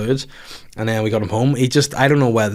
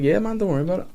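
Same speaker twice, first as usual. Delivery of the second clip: high, softer, airy brush-off; mock reassurance; 8 semitones higher, 4 dB quieter, faster than usual. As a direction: lightly wave their worry away in a raised voice. Retold brush-off to worried friends.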